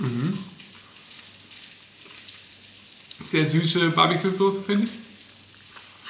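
food in mouth; speaking between bites; chewing while talking. Real, not acted. Seen 0: Woman's cheeks bulge and jaw works after biting burger; still chewing.

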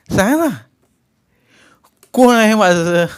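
anguished demand. A narrator's reading. Nephew crying that he has nobody, anguished. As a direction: push the pitch up and plead for an answer.

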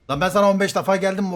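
indignant retort. Real, not acted. Gamer arguing back at teammate, indignant. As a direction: push back at the accusation.

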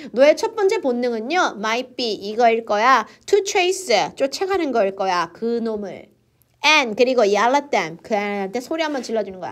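ordinary talk

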